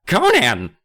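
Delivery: high, loud cry of a name. A narrator's reading. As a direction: call out in alarm. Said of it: "Kermit cried".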